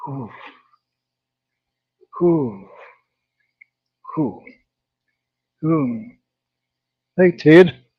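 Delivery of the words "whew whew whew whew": repeated breathless puffs; winded exhales. Real, not acted. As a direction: blow out air, catching breath. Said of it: "got me out of breath".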